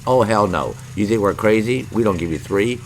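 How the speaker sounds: ordinary talk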